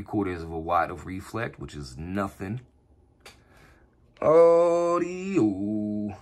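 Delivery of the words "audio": long stretched radio call; drawn-out call into the mic; over the radio. Real, not acted.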